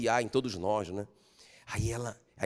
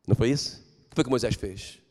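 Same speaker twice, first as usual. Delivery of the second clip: ordinary talk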